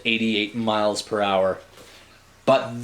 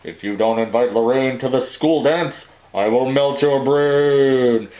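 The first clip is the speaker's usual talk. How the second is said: Darth Vader voice; 4 dB louder than usual. Acted. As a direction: draw out the brain-melting threat. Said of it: "Marty said".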